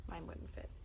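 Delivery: usual speaking voice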